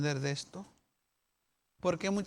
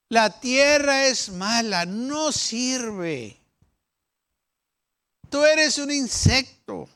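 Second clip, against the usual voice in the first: mock rebuke; 4 semitones higher, 7 dB louder, slower than usual. Preacher voicing the doubters.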